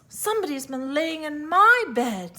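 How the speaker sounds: a lifted mother bear voice; middle-sized bear voice. A narrator's reading. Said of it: "in her middle-sized voice".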